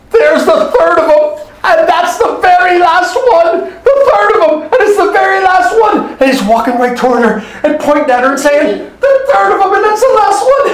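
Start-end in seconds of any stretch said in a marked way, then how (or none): 0.1-6.1 dramatic outcry. Acted. Robin seeing his last sunset, high dramatic outcry. 9.0-10.7 high-pitched exclamation; dramatic outcry. Acted. Robin pointing at the housemaid, high dramatic outcry.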